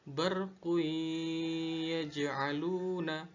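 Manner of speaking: BARQU YAJ'ALUNA slowly chanted, held humming y between words; merged idgham reading. Shown as a demonstration. Teacher reciting the fourth example, "barqu yaj'aluna" with held merge. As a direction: hold "barqu" into a nasal y of "yaj'aluna". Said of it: "Contoh keempat".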